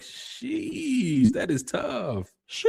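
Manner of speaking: high stretched "Sheesh", slow "that is tough"; amazed sheesh. Real, not acted. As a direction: react like the burn hurt. Reacting to trolling comment.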